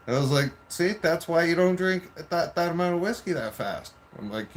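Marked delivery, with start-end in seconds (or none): 0.7-3.9 high, loud told-you-so lecture; retold scolding. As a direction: scold with pitch and volume raised. Retold scolding as she vomits.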